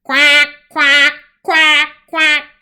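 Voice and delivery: loud quacking "quack"; duck quack. A narrator's reading. Duck answering Olive, loud quacking. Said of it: "came the reply".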